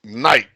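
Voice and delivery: "Nike" said nai-ki, English way. Shown as a demonstration. Teacher giving the English "Nike". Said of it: "Ajá".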